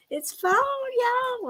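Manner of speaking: high, slow sing-song. Shown as a demonstration. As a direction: stretch it out high and sweet. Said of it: "sing-song seasonal greeting".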